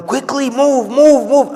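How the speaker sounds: hammed-up mom hurrying her kids; imitating a mother barking at slow kids; imitated angry yell. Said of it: "she was now angry and she was telling them".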